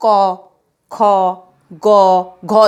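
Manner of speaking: क, ख, ग, घ called out loud, one by one; teacher reciting consonants. Shown as a demonstration. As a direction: sound out each consonant for the class. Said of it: "क बोलते हैं".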